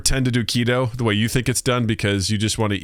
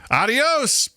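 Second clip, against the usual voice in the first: breezy goodbye; 14 semitones higher than usual. Retold or imitated. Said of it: "it's just like".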